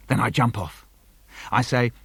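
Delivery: ordinary delivery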